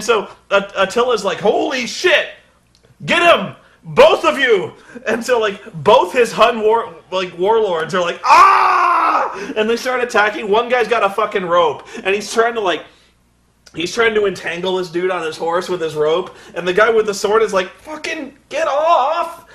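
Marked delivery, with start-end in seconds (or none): 1.4-4.7 much higher, louder panicked commands; shouted orders. Retold or imitated. Attila orders his men. 8.2-9.5 much higher, louder war yell; battle cry. Retold or imitated. Warlords charging. 17.7-19.5 very high, slow stretched-out cry; strained yell. Retold or imitated. Swordsman fighting the rope guy.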